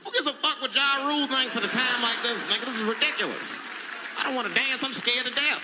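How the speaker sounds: loud, high and fed up; exasperated rant. Real, not acted. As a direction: yell at the TV in disbelief.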